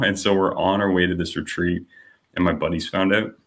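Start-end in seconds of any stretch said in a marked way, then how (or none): none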